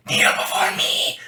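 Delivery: whispered dark lord command. Shown as a demonstration. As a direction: hiss it like a sinister overlord. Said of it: "You go".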